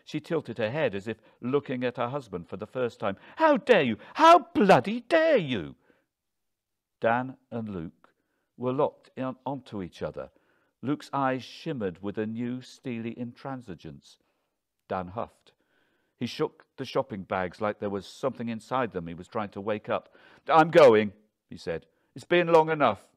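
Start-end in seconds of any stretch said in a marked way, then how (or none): 3.4-5.8 high, loud, furious shout; indignant high-pitched yell; outraged. A narrator's reading. Outraged wife rounds on husband. 20.5-21.9 very loud, raised, fed up; huffy, forceful declaration; angry announcement. A narrator's reading. Husband huffs, announces leaving. 22.1-23.1 loud, high, impatient; huffy, raised-voice impatience; fed up. A narrator's reading. Husband huffs, announces leaving.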